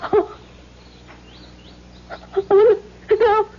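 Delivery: high, crying shock; a wife recognizing her long-gone husband in fear; frightened gasp. Acted. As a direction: realize with dread who it is.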